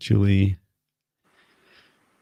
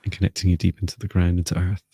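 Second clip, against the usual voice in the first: guided healing voice. Acted. Energy healing, guided session.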